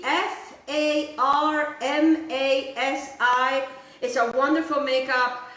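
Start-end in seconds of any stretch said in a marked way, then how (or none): 0.0-3.7 slow, letter by letter; measured spelling with space between letters. Real, not acted. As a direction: spell each letter slowly and distinctly. Spelling out the sponsor's brand.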